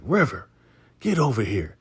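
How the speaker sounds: lightly acted call across a yard; mock calling out. Acted boss calling River over.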